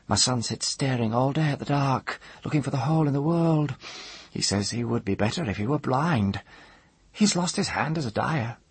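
put-on high old-woman voice, subdued; troubled old woman. A narrator's reading. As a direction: tell it plaintively in a high crone's voice.

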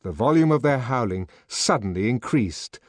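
ordinary delivery, nothing marked

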